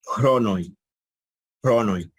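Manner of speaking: cronoi with the final i sounded as oi; old pronunciation shown. Shown as a demonstration. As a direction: say cronoi ending in a clear oi glide. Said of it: "posiblemente lo pronunciaban y decían".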